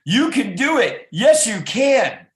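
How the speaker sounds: high, loud cheer, slowing on the end; rally the baby, loud and proud. Acted. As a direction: parents cheering the hatching chick. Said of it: "they were cheering and inspiring".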